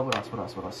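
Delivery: muttered low to self; muttering. Real, not acted. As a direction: mumble it under breath.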